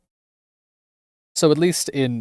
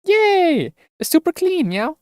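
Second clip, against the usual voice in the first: much higher, bright cheer ending in meow; excited cat girl; 3 dB louder, slower than usual. Acted. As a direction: squeal with delight like a cat girl. Cat girl cheers over cleaned robot.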